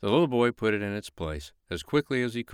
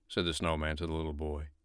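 plain reading voice

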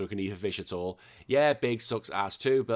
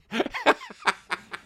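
high laugh; 13 semitones higher than usual. Real, not acted. Laughing at the comment.

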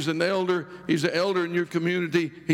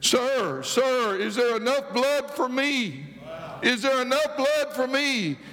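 put-on child's plea; 6 semitones higher, slower than usual. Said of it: "He said".

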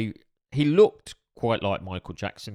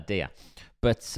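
usual speaking voice

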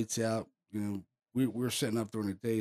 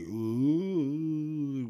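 drawn-out, higher-pitched "ooh"; imitated ghostly moan; 6 semitones higher, 3 dB louder than usual. Investigator describing the sound in the wall, eerie mock moan. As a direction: moan like something coming through a wall. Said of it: "it was like a moan".

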